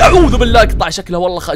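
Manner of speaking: loud, jolted burst of fright; startled outcry. Real, not acted. Gamer startled by a sudden sight, crying out loudly. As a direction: cry out as if caught off guard. Startled by something appearing in game.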